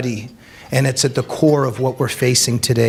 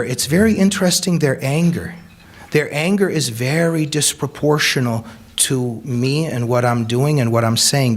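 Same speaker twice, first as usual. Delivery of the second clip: ordinary delivery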